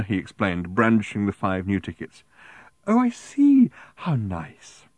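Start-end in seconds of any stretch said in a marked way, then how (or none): 2.9-4.9 very high coo, then dropping; sickeningly smiling. A narrator's reading. Woman answering Arthur, sickeningly sweet. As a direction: coo it primly through a smile. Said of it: "She smiled sickeningly".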